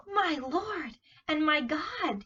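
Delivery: slow, high awed exclamation; slow astonished praise; exclaimed. Acted. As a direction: gasp it out in wonder. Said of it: "he exclaimed".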